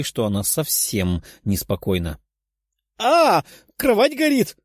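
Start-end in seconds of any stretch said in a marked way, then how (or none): 3.0-4.6 high-pitched, drawn-out cry of alarm; panicked yell. A narrator's reading. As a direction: cry out that there's danger, pitch leaping up. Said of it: "прокричала беловолосая".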